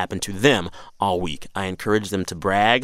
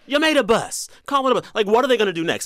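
exasperated rant; 11 semitones higher than usual. Real, not acted. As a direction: rant it out, fed up. Exasperated rant about Lyft.

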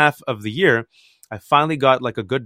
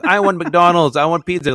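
put-on higher, louder kid's demand; demanding child; 5 semitones higher, 5 dB louder than usual. Boy demanding pizza from his mom, higher and louder. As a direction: insist like a spoiled little boy.

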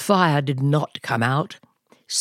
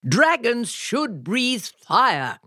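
insisting; 8 semitones higher, slower than usual. A narrator's reading. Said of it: "insisted Crispin's father".

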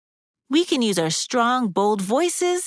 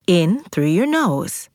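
guided breathing. Acted. Guides belly breathing with music.

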